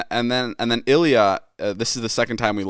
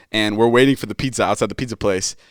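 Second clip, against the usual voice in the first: usual speaking voice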